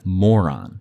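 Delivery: ordinary delivery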